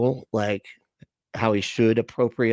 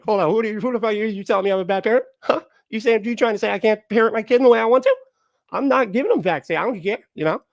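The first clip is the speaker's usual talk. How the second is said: much higher, fast, indignant parent; mock defensive parent; 8 semitones higher, faster than usual. Mocking an indignant parent refusing advice, much higher and fast. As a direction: bristle like a parent told off.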